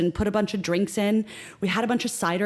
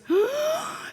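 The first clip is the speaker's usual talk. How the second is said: sharp high gasp of realisation; teary gasp. Retold or imitated. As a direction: gasp as if about to cry. Friend realises it's a wedding.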